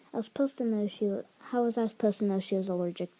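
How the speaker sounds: rushed, defensive; fast defensive protest. Acted. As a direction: hurry the excuse out.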